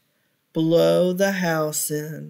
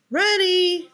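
much higher squeaky pig voice; cheeky little pig; 12 semitones higher than usual. Acted. Little pig telling the wolf he already went, high squeak. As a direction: chirp it high and pleased.

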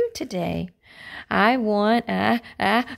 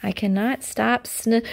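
plain reading voice

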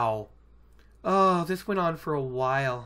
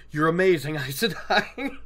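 gushing through a laugh; delighted, laughing admiration; laughing praise. Acted. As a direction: break into laughter while praising.

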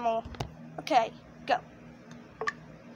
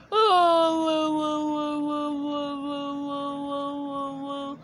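siren-like wailing; slower than usual. Shown as a demonstration. Director showing Jeffy's noise, long fading wail. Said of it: "Jeffy is gonna be like".

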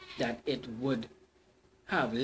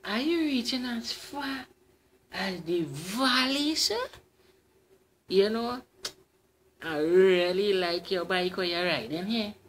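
high, dainty lady's voice; dainty feminine voice; 4 semitones higher than usual. Acted. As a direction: coax him with a ladylike lilt. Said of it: "In a dainty feminine voice".

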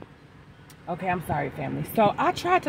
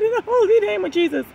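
high and loud on holy, much higher; raised preaching cry; 12 semitones higher, 4 dB louder, faster than usual. Real, not acted. Preacher repeating her prayer in a raised, high cry. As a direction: proclaim it out loud, stressing holy. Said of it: "I said".

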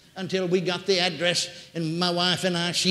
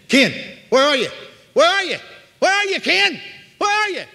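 high, loud calls climbing higher; desperate calling; 11 semitones higher, 8 dB louder than usual. Retold or imitated. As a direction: cry out searching for someone in the dark. Dying woman searches for Ken.

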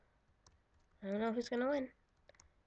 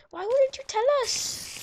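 high, louder whiny complaint from viewers; mock viewer yell; 13 semitones higher, 7 dB louder than usual. Imitating viewers yelling at him, high and loud. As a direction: squeal it like an annoyed fan. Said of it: "then you yell at me just like".

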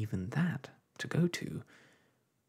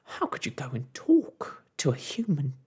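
higher and louder, wailing reproach; wailing scolding; 7 semitones higher, 6 dB louder than usual. A narrator's reading. Mother scolding her daughter, loud wailing reproach. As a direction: scold her through a wail. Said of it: "wailed Homily suddenly".

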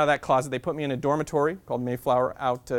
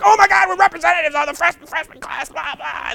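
high, loud gushing excitement; super excited; 11 dB louder than usual. Retold or imitated. Fellow representatives gushing at their first meeting, high and loud. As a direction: squeal it like thrilled new reps. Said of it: "super excited".

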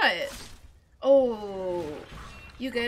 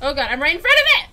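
fast, much louder panicked blurt; real panic; 11 dB louder, much faster than usual. Real, not acted. Player caught in front of a dragon, panicked fast blurt. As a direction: rush it out in alarm.

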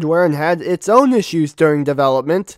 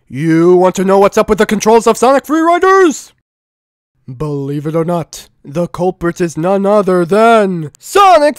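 dramatic announcer; 4 semitones higher, 7 dB louder than usual. Acted. Dramatic reveal of the culprit.

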